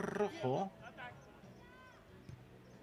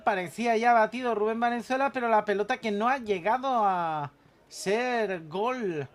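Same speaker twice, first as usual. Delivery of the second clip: excited; 7 semitones higher, 6 dB louder than usual. Real, not acted. Near goal, fast call.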